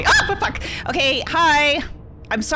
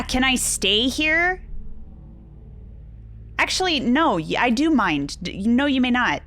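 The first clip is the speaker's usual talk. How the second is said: ordinary talk, nothing marked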